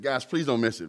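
ordinary delivery

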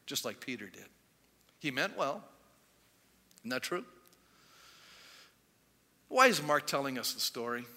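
ordinary delivery

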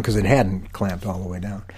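ordinary delivery

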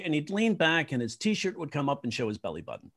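usual speaking voice

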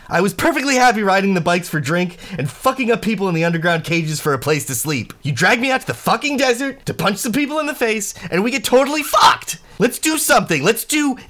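furious high-pitched rant; angry yelling. Acted. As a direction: yell it, fed up and furious. New ranting at Kai.

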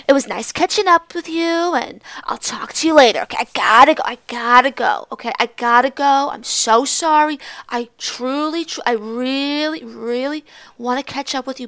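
loud, overdone apologetic goodbye; gushing excuse-making. Retold or imitated. Classmate begging off from catching up, mock gushing apology. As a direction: lean hard on each apology, hammed up.

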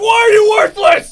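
high screaming rage through a laugh; laughing mock scream; mock furious yell. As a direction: shriek it like an unhinged dad. Dad screaming over a shoe.

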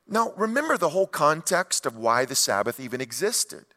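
ordinary delivery

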